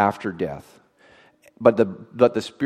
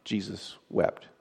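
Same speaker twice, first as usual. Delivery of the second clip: hushed and slow; 4 semitones lower, 10 dB quieter, slower than usual. Shown as a demonstration. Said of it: "That is the shortest verse in the Bible".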